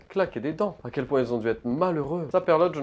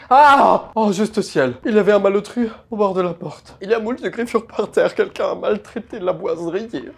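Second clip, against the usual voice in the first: loud, high panicked exclaiming; scream, then keep voice high and loud; alarmed outcry; 7 semitones higher, 10 dB louder than usual. Real, not acted. Player frightened by intruder at the door, alarmed outcry.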